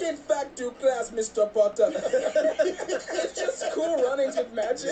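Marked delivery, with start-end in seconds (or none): 0.0-1.9 put-on Jamaican accent. Jamaican Hogwarts teacher orders Potter to class, slow Jamaican accent. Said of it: "Jamaican Hogwarts".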